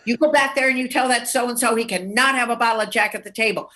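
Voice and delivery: high, emphatic, rushed bartender's rebuke; mock indignant refusal. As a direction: send the waitress back, imitating a fed-up barman. Bartender refuses again, acted.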